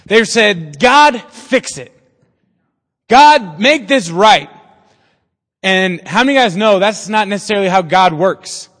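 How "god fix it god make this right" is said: louder, higher, slow mock plea to God; hammed-up begging, drawn out; pleading prayer. Pastor imitating people begging God to fix things, mock pleading.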